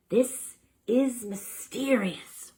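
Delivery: very slow, drawn-out words; slow turtle voice. A narrator's reading. Professor Turtle puzzling over the mystery, very slowly. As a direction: speak as slowly as a turtle would. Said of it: "as slowly as you'd expect a turtle to speak".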